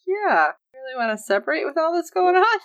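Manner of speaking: high-pitched, doubting question; incredulous. Acted. Podcaster reacting to characters separating amid the danger, incredulous. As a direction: question the plan in high mock disbelief.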